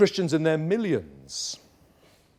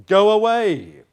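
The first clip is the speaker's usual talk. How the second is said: higher, louder Go away, cutting it off; sharp dismissal; 6 semitones higher, 8 dB louder, slower than usual. Acted. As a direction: send self packing. Self denied, sent off.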